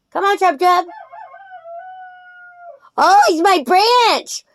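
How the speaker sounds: high, sing-song coaxing to a pet rooster; doting pet talk. Real, not acted.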